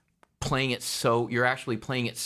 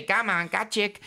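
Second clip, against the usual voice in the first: higher mock Chow accent, tossed off; Mister Chow accent; 9 semitones higher than usual. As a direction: quote his Chow line "Come on, got chick." in the accent. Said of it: "So I say".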